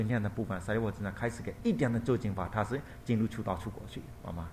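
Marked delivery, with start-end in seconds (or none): none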